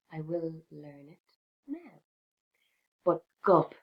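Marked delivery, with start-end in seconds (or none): 0.1-2.0 quiet resolve. A narrator's reading. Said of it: "thought the mouse".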